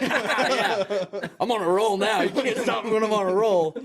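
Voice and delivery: high, laughing, cocky brag; giddy brag to his supervisor. Retold or imitated. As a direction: laugh and boast you can't be stopped.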